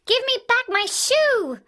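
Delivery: put-on high, loud, furious little girl; a child shouting for her shoe; loud angry cry; angry demand. A narrator's reading. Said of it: "grew angry and said".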